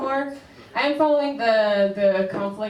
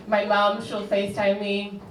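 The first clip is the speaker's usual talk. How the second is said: ordinary delivery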